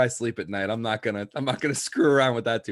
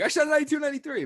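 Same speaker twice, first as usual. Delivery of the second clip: much higher, proud mock announcement; mock bragging voice; much faster than usual. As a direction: pipe up like a kid bragging. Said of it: "I'd be like".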